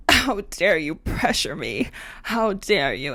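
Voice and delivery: lightly voiced indignant guy; mocking the ex. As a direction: imitate the ex clutching his pearls. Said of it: "And he was like".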